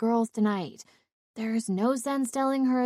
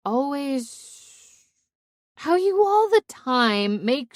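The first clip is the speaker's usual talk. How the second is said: drawn-out words, bursting louder and higher; tipsy gushing friend; 3 semitones higher, 5 dB louder, slower than usual. Acted. Drunk woman phoning her best friend, tipsy gushing. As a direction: gush drunkenly, stretching the key words.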